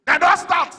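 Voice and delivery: high, loud alarmed yell; shouting. Retold or imitated. Running man yelling a warning at bus stop, shouting. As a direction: yell it out far above normal talk. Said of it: "he was shouting".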